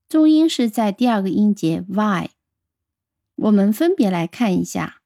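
ordinary delivery

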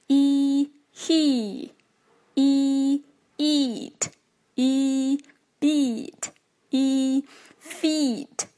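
e, he, eat, beat, feet with a stretched "ee"; long ee drill. Shown as a demonstration. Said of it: "长一音要拉长".

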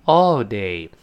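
"all day" slow with a loose open mouth; relaxed American. Shown as a demonstration. Teacher demonstrating relaxed American all day. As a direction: let "all day" fall out lazily. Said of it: "嘴是完全放松的".

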